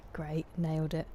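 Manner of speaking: dry ironic praise of her own mistake; deadpan self-mocking. Real, not acted. Mocking her bad Portuguese to chat, dry and flat. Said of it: "Sounded very British".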